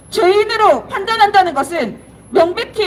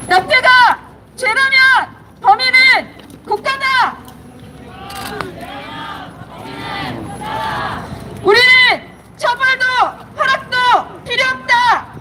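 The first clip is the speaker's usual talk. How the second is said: protest slogan; 4 semitones higher than usual. Real, not acted. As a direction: deliver each slogan line deliberately, with pauses. Protest slogans read slowly.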